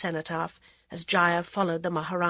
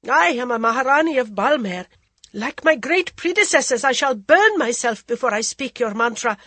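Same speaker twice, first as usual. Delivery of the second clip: high, loud furious declaration; angry queen; 6 semitones higher, 8 dB louder than usual. Acted. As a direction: hurl her vow at the ascetic. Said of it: "the Maharani's anger".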